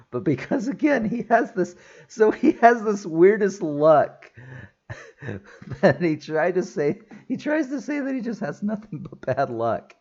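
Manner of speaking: high-pitched, squeaky with real laughter; laughing while talking. Real, not acted. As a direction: struggle to get it out through giggles. Amused by character's absurd luck.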